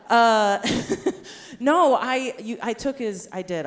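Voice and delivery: ordinary talk, nothing marked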